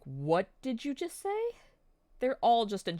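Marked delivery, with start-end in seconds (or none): 0.0-1.6 shocked. Retold or imitated. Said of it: "They're like".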